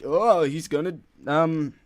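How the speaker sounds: mock surprise. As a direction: feign amazement at an obvious twist. Mocks the film's predictable ending twist.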